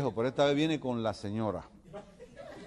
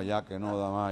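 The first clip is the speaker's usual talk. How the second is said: ordinary talk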